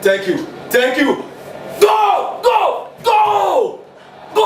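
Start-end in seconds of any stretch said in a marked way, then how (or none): 1.8-4.4 yelling at the TV. Real, not acted. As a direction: urge the players on at full voice. Husband yelling at football on TV.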